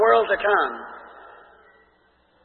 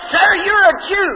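high, slow, raised woman's voice; a woman protesting to a stranger, pitched up and slow; Samaritan woman; 6 semitones higher than usual. Retold or imitated. Samaritan woman answers Jesus at the well, high and slow.

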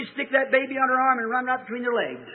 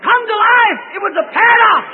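high, loud triumphant cry; preaching shout; 10 semitones higher, 9 dB louder than usual. Real, not acted. Preacher exclaims about the miracle.

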